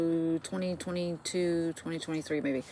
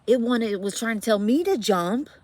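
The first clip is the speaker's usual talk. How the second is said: loud and higher, alarmed; 5 semitones higher, 9 dB louder than usual. Real, not acted. Telling viewers about the ghost, loud and alarmed. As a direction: voice jumping up with alarm. Building to scary climax.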